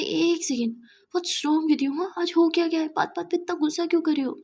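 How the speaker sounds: rising exasperation at her moods; exasperated. Acted. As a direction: demand to know what's wrong. Friend fed up with tantrums.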